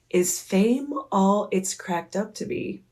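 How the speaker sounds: measured, distinct repeat of a question; repeated, clearer question. Retold or imitated. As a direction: ask it carefully for someone who missed it. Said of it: "And I was like".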